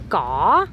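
"Cỏ" drawn out with a deep dip and rise; pronunciation demo. Shown as a demonstration. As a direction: model "Cỏ" low, then swooping up. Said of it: "con nói đi". (đọc mẫu "Cỏ", kéo dài dấu hỏi)

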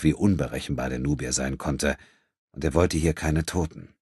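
ordinary delivery

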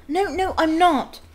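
high, loud protest; hasty denial. A narrator's reading. Hermione denying the spew scheme to Ron, hasty. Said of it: "she said hastily".

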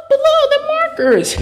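put-on very high, slow child's whine; a kid wailing over his markers; whining kid.

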